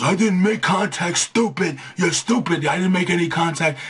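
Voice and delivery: a high cartoon brute protesting "stupid"; mock wrestler voice. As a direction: play the big guy whining that he never touched him, way up high. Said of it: "He goes".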